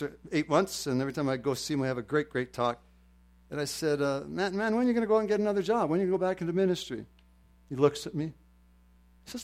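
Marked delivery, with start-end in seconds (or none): 4.3-7.1 quick, higher-pitched pestering question; urging question. Retold or imitated. Preacher retelling how he pressed his retired pastor friend, urging. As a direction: press him with rapid questions.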